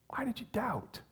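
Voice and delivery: soft, breathy whispered question. Pretend. Jesus to sinking Peter, hushed whisper. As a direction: whisper it gently close to Peter.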